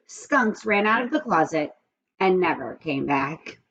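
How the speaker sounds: usual speaking voice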